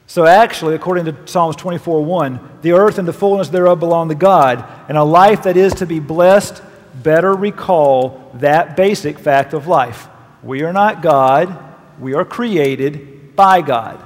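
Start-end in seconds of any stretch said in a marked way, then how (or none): none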